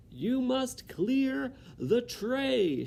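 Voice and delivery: very high, slow bellow; Cook shouting. Acted. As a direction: shout each word slowly at a dim girl. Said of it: "shouted Cook".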